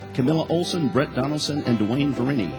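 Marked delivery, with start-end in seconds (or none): none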